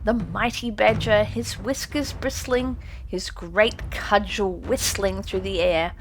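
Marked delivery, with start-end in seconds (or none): none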